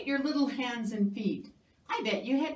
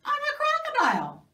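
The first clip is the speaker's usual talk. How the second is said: rising then loud crocodile; spring the surprise; big reveal; 6 dB louder, much faster than usual. Acted. Crocodile revealing itself to the mouse, big and loud.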